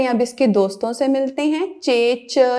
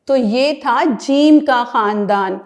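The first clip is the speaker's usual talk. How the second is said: usual speaking voice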